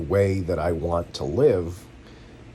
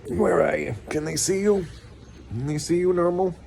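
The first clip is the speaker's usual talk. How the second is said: much higher cooing voice for the dog; sing-song coo to a little pup; pet baby talk; 10 semitones higher than usual. Acted. Calling his little dog over, in high baby-talk coo.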